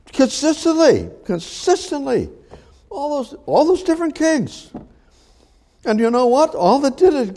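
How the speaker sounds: soaring high, preacher's rising fervor; high preaching cry. Real, not acted. Preacher to congregation, soaring high cry. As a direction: lift the voice high and ring it out.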